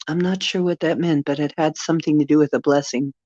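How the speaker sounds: normal talking voice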